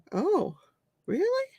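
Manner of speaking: ordinary talk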